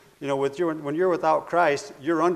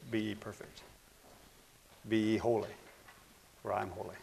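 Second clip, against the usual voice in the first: hushed scripture; 5 semitones lower, 11 dB quieter than usual. Acted. Preacher repeating the verse to his congregation, hushed and soft. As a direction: softly quote the verse, low and hushed.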